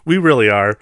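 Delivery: ordinary delivery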